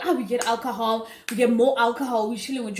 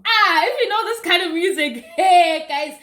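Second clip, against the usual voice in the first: louder, laughing and higher; laughing excited; 6 semitones higher, 7 dB louder than usual. Real, not acted. Remembering the music with her viewers, laughing and excited.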